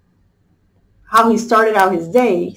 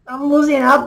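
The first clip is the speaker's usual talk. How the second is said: usual speaking voice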